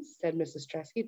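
ordinary delivery

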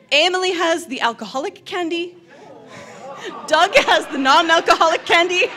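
can't keep a straight face announcing the candy; laughing. Real, not acted. Presenter to the audience, announcing who has the candy, laughing.

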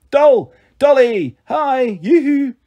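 high, loud, excited shout; excited calling out. Acted. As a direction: call across a playground to get attention. Excited mother calling across playground.

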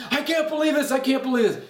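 high, panicked disbelief; freaking out. Retold or imitated. Wife freaking out to her husband, high panicked. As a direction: fret in rising panic. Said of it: "she's freaking out".

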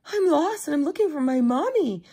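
high, loud storybook wail; wailing duckling. A narrator's reading. Lost duckling tells Beaver its trouble, wailing high. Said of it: "wailed the poor little duckling".

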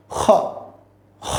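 খ with air scraping out at the back of the mouth; back-of-mouth friction. Shown as a demonstration. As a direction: rub the breath through on খ. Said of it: "এভাবে।". (বাতাস লাগিয়ে খ উচ্চারণ)

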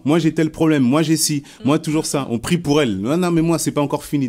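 voix plus aiguë et plus forte, plaintive; imitation de la mère qui se plaint. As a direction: se plaindre sans fin, tout ramener à soi. (la mère amère imitée, aiguë et forte)

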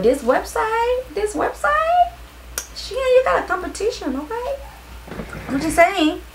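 playful high voice calling out a rival; high teasing squeal. Real, not acted. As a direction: tease Shein in a high-pitched sing-song. Teasing Shein about a competitor.

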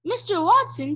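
high, urgent call; shout. A narrator's reading. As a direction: summon a husband from another room. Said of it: "she shouted".

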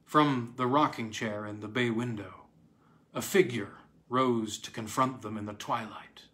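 plain reading voice

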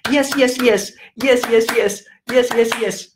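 high, rapid-fire chanted yeses; excited pupils. Retold or imitated. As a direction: imitate children cheering the right answer. Imitates students clapping along approvingly.

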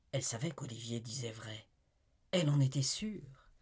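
usual speaking voice

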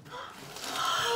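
sharp excited gasp; breathless gasp of surprise. Real, not acted. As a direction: gasp in delight. Opening prize box.

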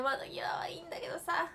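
whispered, voiceless grumble. Real, not acted. Whispering a sulky complaint to viewers. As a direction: mutter the complaint under her breath.

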